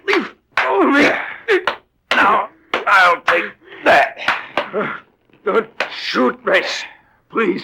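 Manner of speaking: high, strained plea; terrified plea during the struggle; frightened pleading. Acted. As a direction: beg for your life.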